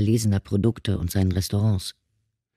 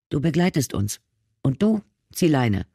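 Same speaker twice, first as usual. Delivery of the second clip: curt orders; 4 semitones higher than usual. Acted. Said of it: "befahl sie dem Taxifahrer".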